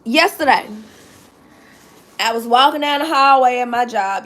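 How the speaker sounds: ordinary delivery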